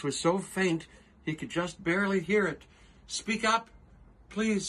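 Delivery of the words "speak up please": much higher, straining to be heard; calling up higher. Acted. Horton asking the faint voice to speak up, higher. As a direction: call out gently to a tiny voice.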